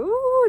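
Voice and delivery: a high, loud, pleased ooh; mock delighted ooh. Telling viewers about a balm, a high loud ooh. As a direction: squeal a happy ooh as the makeup melts. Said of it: "you put it on your eyes and you go".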